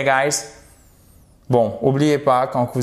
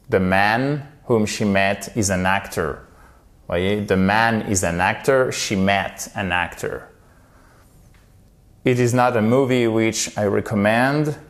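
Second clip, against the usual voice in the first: ordinary talk, nothing marked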